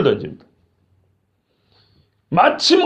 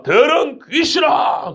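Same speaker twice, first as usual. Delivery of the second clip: stern rebuke; 9 semitones higher, much slower than usual. Retold or imitated. Jesus commands the unclean spirit, slow high shout. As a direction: drag out 더러운 as a commanding shout. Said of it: "그 아이를 보고 한다는 소리가".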